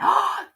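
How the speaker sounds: sharp high gasp of realisation; sudden gasp. Real, not acted.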